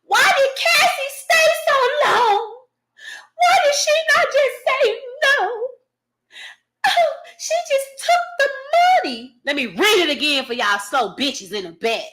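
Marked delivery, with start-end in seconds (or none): 0.0-9.4 high, whiny mock voice, a crying tone; high sneering mock cry; mocking victim blamers. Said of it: "all y'all that said that".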